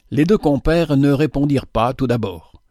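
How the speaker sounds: ordinary delivery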